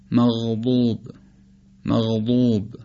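مغضوب with the ض starting as a stop and easing into ظ; old classical ḍād. Shown as a demonstration. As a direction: demonstrate مغضوب with ض gliding from stop to continuant. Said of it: "سعی کنیم با ضاد قدیمی تلفظ بکنیم".

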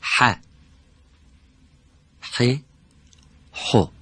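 ح ح حو with a breathy throat ح on each vowel; teacher models ح with vowels; drill the letter ح. Shown as a demonstration.

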